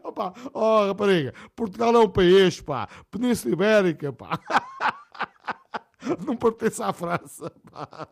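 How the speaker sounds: loud laughing protest; indignado a rir. Real, not acted. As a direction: scold the blonde, laughing, loud. Locutor ralha com a loira, rindo. (protesto alto, a rir)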